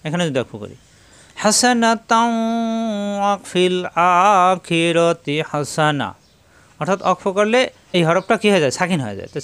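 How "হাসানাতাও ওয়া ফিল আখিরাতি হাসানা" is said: slow chanted dua, higher and drawn out; Quranic recitation. Shown as a demonstration. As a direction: recite it as a model for students. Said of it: "তাহলে কীভাবে পড়ব".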